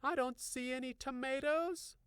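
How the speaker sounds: high voice, out of breath; panting. A narrator's reading. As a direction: say it while catching breath. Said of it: "panting loudly".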